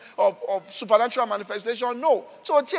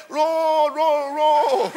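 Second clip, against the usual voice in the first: mock rolling beggar; 4 semitones higher, 4 dB louder, much slower than usual. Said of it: "he will beg".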